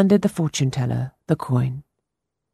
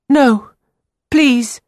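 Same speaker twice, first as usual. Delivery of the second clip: put-on higher, louder pleading boy; urgent boy; 10 semitones higher, 6 dB louder than usual. A narrator's reading. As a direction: insist desperately as the young boy.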